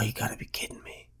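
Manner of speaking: breathy whisper, exasperated; whispered disbelief. Real, not acted. Price suddenly drops.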